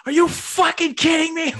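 hammed-up sports-fan outrage, very high and loud; passionate outburst. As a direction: yell it like a fan watching the game go bad. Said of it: "when we get passionate".

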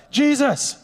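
high urgent call over a crowd; desperate father shouting for Jesus through the crowd. Acted. Desperate father calls out to Jesus over the crowd, high shout.

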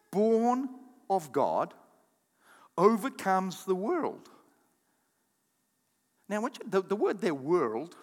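ordinary talk, nothing marked

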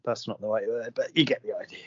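a quick whispered aside. Real, not acted. After fumbling a word to his co-host, a whispered aside.

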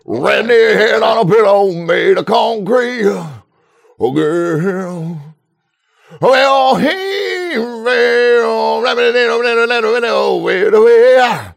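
high mumbled rock belting. Pretend. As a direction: slur lyrics like a rock frontman. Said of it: "my [name] impression".

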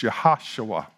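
"Yahashua" with a strong stress on HASH; Hebrew pronunciation. Shown as a demonstration. Pastor teaching the Hebrew name, "Yahashua" stressed on HASH. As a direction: punch the second syllable of "Yahashua", yah-HASH-oo-ah. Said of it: "in Hebrew".

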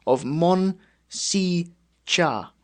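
slow, syllable by syllable Mon Si Cha; phonetic spelling. Shown as a demonstration. Reading the phonetic guide aloud slowly, syllable by syllable. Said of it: "a pronunciation, it's all phonetic".

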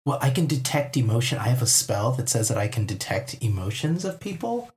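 normal talking voice

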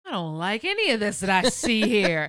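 loud, lofty offended decree; indignant proclamation. Retold or imitated. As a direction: declare it like a self-important ruler. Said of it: "walking in and saying".

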